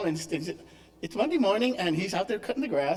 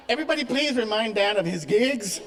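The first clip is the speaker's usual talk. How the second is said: laughing; 4 semitones higher, 5 dB louder than usual. Real, not acted. Joking to congregation about Dan.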